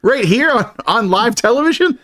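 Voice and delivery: high-pitched incredulous protest; mock outrage. Co-host defending his man on air, high mock outrage. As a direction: protest in hammed-up disbelief.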